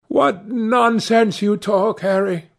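high, slow youthful exclamation; protesting cry. A narrator's reading. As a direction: cry out in fond protest, pitch well up. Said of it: "cried the lad".

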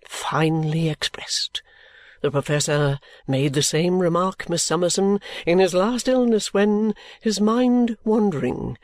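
defer fully to his wife's judgement; deferential Mr Badger.

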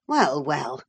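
mildly cross husband; a touch of impatience. A narrator's reading. Husband checks his excited wife, mildly cross. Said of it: "cried her husband crossly".

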